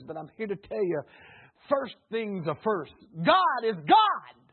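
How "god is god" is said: louder, slow, weighty declaration; emphatic proclamation. Real, not acted. Preacher proclaiming God to the church, slow and loud. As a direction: hammer each word down.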